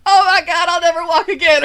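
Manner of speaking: high, loud mock wailing; hammed-up pain through laughter; over-the-top agony. Mimicking the actress after the crotch hit, over-the-top agony. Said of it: "over the top and starts going".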